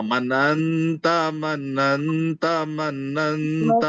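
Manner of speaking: "मनंता" drawn out very slowly, syllable by syllable; slow drill repeat. Shown as a demonstration. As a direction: say "मनंता" slowly three times for the class to copy. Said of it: "आप लोग ये पूरे बात करना".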